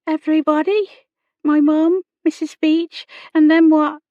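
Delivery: put-on high girlish voice listing names; young girl, pitched well up; dejected young girl. A narrator's reading. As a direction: list them high, as an upset servant girl. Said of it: "The girl's words trailed away".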